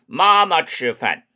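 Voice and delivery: 마마 치饭 very slowly; 妈妈吃饭 慢读; slow Chinese sentence. Shown as a demonstration. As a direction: model 마마 치饭 word by word. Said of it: "이러면 됩니다".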